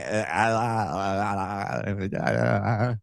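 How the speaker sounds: stammering mimicry. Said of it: "He is mimicking Trump's mannerisms".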